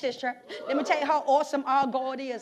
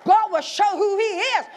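louder and louder preaching over the organ; full-force preaching; 2 semitones higher, 4 dB louder than usual. Acted. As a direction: preach it, building the fire. Preaching over music, building.

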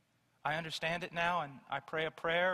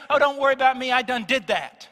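imitated Southern churchgoer; 8 semitones higher, 14 dB louder than usual. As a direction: mimic the dismissive reply "I done did that" with a raised voice. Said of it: "they say".

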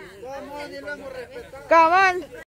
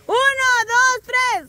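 shouted countdown; 6 semitones higher, slower than usual. Real, not acted. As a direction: call the count out to the room. Host calls countdown.

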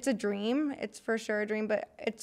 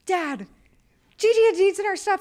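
excited; 10 semitones higher, 7 dB louder than usual. Retold or imitated. Excitedly telling dad news.

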